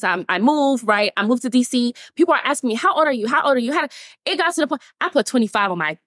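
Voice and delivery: ordinary delivery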